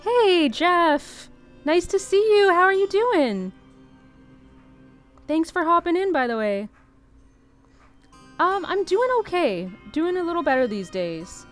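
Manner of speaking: high, loud cheerful hello; excited greeting. Real, not acted. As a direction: bubbly welcome to a friend. Greets a viewer named Jeff.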